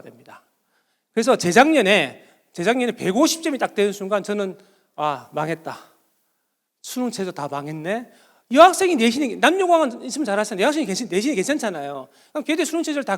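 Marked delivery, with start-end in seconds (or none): 4.9-8.1 low, quiet sinking realization; mock-despairing mumble to oneself; retold dismay. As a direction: drop low and soft, as if all is lost. Retells own dismay at hard exam.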